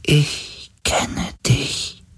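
high, very slow, whispery spirit voice; weak dying spirit. A narrator's reading. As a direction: let each word fade like a dying being. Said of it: "Die Stimme eines sterbenden Wesens".

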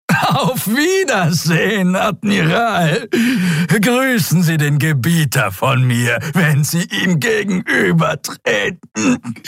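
langsam, hoch und spöttisch gerufen; höhnischer Abschied. A narrator's reading. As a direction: triumphierend im Sterben. Sterbender Draxyl lacht höhnisch. (spöttischer Abschiedsruf)

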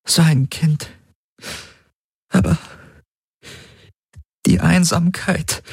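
struggling to get the words out; tearful confession. A narrator's reading. Her tearful confession in the story.

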